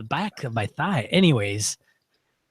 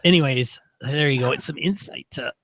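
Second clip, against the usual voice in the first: ordinary talk, nothing marked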